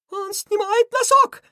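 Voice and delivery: very high, loud cartoon panic; high-pitched villain terror; hysterical scream. A narrator's reading. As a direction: scream it in hysterics, words stretched. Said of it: "истерично закричал Крысион".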